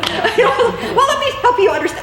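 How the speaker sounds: high, loud, laughing mock tirade of a wife; hammed-up accusing wife, laughing; mocking nagging wife. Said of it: "women, like, pause, "Oh, we gotta fill it with words".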